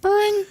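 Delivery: high, loud mock ring; phone ring. Player makes a loud ringing-phone noise.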